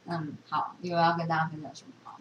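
ordinary delivery

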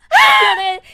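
high, loud laughter through the words; loud laughter breaking into the telling; laughing hard. Real, not acted.

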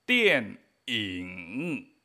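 电 cae desde arriba, 影 grave y largo; cuarto tono descendente. Shown as a demonstration. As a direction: marca la caída de 电 y alarga 影 abajo. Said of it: "Cuarto tono hacia abajo". (tonos de 电影 bien marcados)

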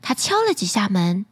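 plain reading voice

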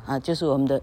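usual speaking voice

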